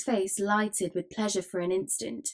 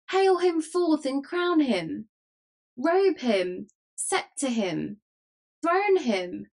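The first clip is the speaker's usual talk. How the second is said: high, rousing calls; crowd cries; 6 semitones higher, 4 dB louder, slower than usual. A narrator's reading. Rowdy crew calls to crown the mock king, crying out. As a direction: cry out each order to the mob, pitched up. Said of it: "These and twenty other cries broke out at once".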